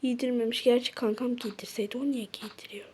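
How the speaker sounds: soft whispered grumble; whispered aside. Acted. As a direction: whisper it to yourself. Fısıltıyla kendi kendine söylenme. (fısıltıyla söylenme)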